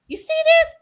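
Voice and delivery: high-pitched insistent friend; mock confrontation. Friends confronting her with pictures.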